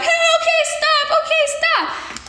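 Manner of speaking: very high, whiny mock plea to stop; mock pleading. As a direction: squeak it like she's begging you off. Friend pleading during the fight.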